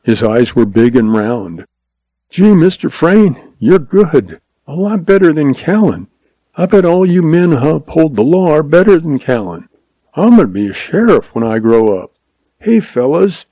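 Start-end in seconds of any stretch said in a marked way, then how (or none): 2.3-13.4 put-on higher-pitched, awestruck kid; admiring boy calling out. A narrator's reading. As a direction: play an eager young boy in awe. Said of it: "he called".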